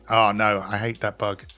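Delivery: ordinary talk, nothing marked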